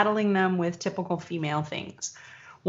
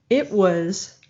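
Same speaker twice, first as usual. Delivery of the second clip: ordinary talk, nothing marked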